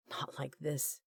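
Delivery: hushed inner thought. A narrator's reading. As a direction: say it under the breath, inward. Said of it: "his mind screamed".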